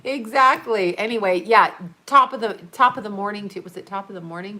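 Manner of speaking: ordinary talk, nothing marked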